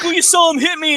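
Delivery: high, mocking complaint; whiny kid. Retold teen pleading with the cops, high and whiny. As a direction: play the indignant tattletale.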